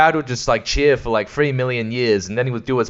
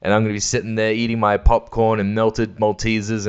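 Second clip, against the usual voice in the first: ordinary talk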